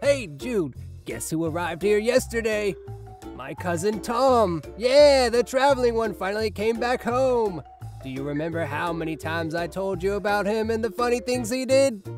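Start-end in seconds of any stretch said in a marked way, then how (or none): 4.8-7.6 high, jovial family news; excited announcement. Acted. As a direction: share the homecoming with gusto. Father announces cousin's return.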